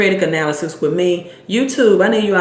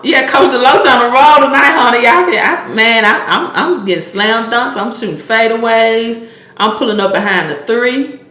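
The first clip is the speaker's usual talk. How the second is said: high, loud sporty bragging; raised-pitch, fired-up swagger; playful hype; 5 semitones higher, 4 dB louder than usual. Pretend. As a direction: boast like a coach showing off on court. Hyped boast as coach persona.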